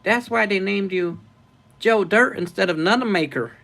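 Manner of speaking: high-pitched movie-character imitation; Joe Dirt. Streamer quotes a comedy film to viewers, high imitated voice. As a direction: push the pitch well up in character. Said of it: "I can do Joe Dirt".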